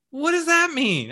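high, bewildered question. Retold or imitated. Friend voiced confused.

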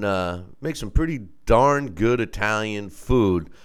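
ordinary talk, nothing marked

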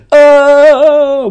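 long, high, loud "ohhh"; drawn-out burn reaction; mock jeering "oh". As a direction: hold a loud, taunting oh. Jeering at the imagined put-down.

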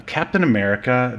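ordinary talk, nothing marked

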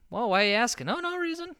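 evasive roommate. Acted. Roommate's evasive answer acted out.

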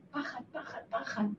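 whispered, barely voiced; hushed fear. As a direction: let the word out in a scared whisper. Re-living a night of fear.

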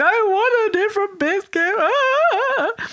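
high-pitched mock tantrum whine; wailing child. Child keeps going about the broken biscuit, mock wailing.

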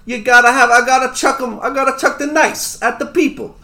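high, punchy New Yorker voice; New York accent. Acted. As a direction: play it as a brash New Yorker. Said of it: "this is now my best accent".

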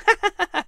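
high, light chuckle at himself; a quick amused laugh; boyish laugh. Acted. Boy Patrick laughing at his own joke to the fish. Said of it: "He laughed at himself".

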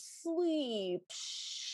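drawn-out command to sleep, trailing into a hush; hushing lion. Acted. Lion shushes the protesting girl to sleep, hammed-up hushing. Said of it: "And he goes".

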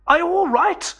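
high, loud call across water. Acted. Benchley to the narrator struggling in the water, calling out high and loud. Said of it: "called Benchley".

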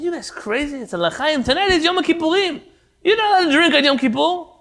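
high, loud, scandalized; appalled they would even ask; indignant shock. Retold or imitated. Leibel shocked at the offer.

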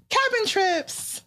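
high and slow, sing-song mock innocence; mock innocent. Telling parents about a weekend away, slow mock innocent sing-song. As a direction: sell the lie sweetly. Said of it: "when we say".

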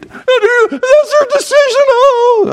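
high, slow, whiny sulk with a laugh; mocking a wife's pouty guilt trip; whining mock wife. Preacher mocks a wife's emotional blackmail, whining high. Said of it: "The emotional blackmail treatment".

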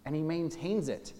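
ordinary talk, nothing marked

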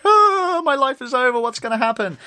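high-pitched wail of despair, hammed up; a squeaky, overdone cry of despair; mock panic. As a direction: play the doom of a ruined man, high and wailing. Panicking at the dinner chair.

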